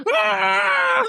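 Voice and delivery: very high, shouted imitated crowd roar; the crowd goes absolutely nuts; mock stadium roar. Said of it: "and they all".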